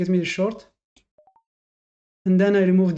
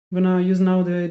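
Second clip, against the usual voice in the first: ordinary talk, nothing marked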